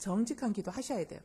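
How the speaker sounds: normal talking voice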